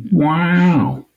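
drawn-out awed "Wow" like Owen Wilson; breathy surfer-style wow; Owen Wilson impression. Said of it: "That's my Owen Wilson impression".

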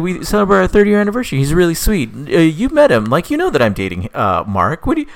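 put-on girl defending her boyfriend.

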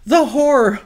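gasp, then high loud horror; hammed-up shocked lady; mock horror. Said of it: "She's like".

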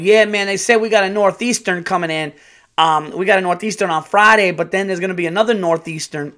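mock hygienist. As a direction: play her chatty mispronouncing voice. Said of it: "she started fucking words up".

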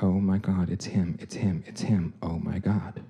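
put-on child seeing a van at night, terrified; frightened hush. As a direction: whisper it in fear.

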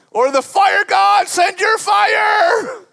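very high, drawn-out preacher's shout; shouted plea. Acted. Preacher voices Elijah's demand for fire, high impassioned shout. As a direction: call down fire at full cry.

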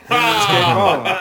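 laughing. Real, not acted. Laughing punchline.

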